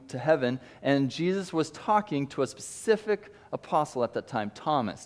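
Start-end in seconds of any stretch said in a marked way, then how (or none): none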